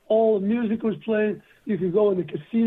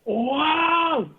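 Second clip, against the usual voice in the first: amazed; 7 semitones higher than usual. Retold or imitated. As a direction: blown away by the poem. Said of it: "And [name] just went".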